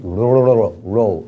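"Rho" with the R trilled on the tongue; rolled R. Shown as a demonstration. Teacher demonstrates Rho's tongue sound, rolled R. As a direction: curl and roll the tongue through "Rho". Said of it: "这个Rho是R的卷舌音".

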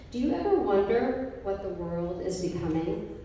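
normal talking voice

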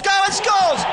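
rising shout on scores; goal yell. Real, not acted. Commentator calls a goal.

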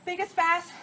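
ordinary talk, nothing marked

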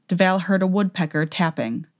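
ordinary delivery

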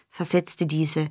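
ordinary delivery

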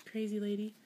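ordinary delivery

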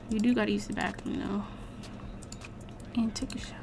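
a breathless whispered aside; hushed reminder to herself about the shower. Real, not acted. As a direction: whisper it under your breath. Aside to her Sim, whispered.

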